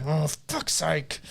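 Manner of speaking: slow sighed muttering curse, mock-weary; imitated exasperated toddler. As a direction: sigh heavily, then grumble it slowly. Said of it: "and he'll go".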